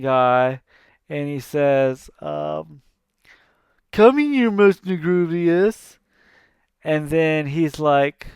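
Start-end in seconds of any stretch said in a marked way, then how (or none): 3.9-6.0 higher, louder sarcastic summons; mock call. Hades calling his minion, sarcastic and high. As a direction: call him over with a sneer.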